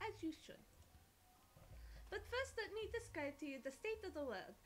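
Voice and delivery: put-on playful French-accented storyteller; horrible French accent. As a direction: tell it with a hammed-up French accent. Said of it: "Excuse my horrible French accent".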